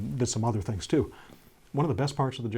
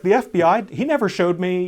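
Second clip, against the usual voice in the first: mock indignant; 6 semitones higher, 6 dB louder than usual. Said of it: "I was like".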